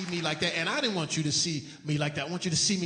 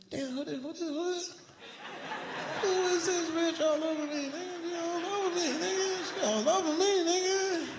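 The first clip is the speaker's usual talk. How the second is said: high whiny disbelief; 12 semitones higher than usual. Retold or imitated. Confused about woman all over him.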